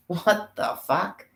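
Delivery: high, slow, put-on "what the fuck"; cartoonish shocked voice; mock outrage. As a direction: ham up a baffled, outraged cry. Acted outraged exclamation.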